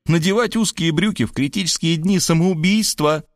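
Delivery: put-on high whiny girl voice, chatty and aggrieved; young woman Varvara, high and complaining. A narrator's reading. Varvara whining to Rob about her tight jeans, high.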